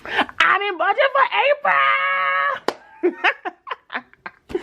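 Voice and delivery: a real joyful half-laughed shout; excited. Real, not acted. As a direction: stretch out every word in triumph. Celebrating staying in budget.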